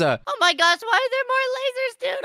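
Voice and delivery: play-acted dismay; exasperated alarm. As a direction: protest it in a high voice. More lasers appear.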